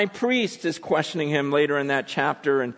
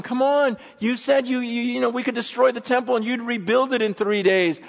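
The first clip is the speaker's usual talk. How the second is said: high, incredulous taunting; mocking; 11 semitones higher, 5 dB louder than usual. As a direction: pile on jeering challenges. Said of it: "the people here are mocking him".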